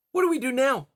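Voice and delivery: a small fox asking, worried; high young fox voice. Acted.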